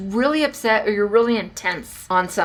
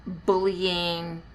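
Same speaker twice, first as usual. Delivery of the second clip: ordinary talk, nothing marked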